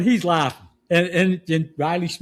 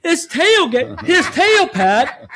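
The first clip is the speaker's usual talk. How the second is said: laughing; 10 semitones higher, 4 dB louder, slower than usual. Real, not acted. Laughing through punchline.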